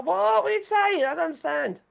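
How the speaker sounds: ordinary talk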